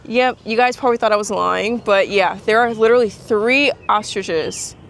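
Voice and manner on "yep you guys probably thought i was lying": normal talking voice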